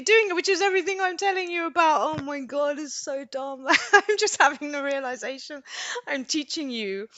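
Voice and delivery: high, loud tearful laughing; real crying. Real, not acted. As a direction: laugh through tears at a sudden realization. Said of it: "I can't believe I'm crying".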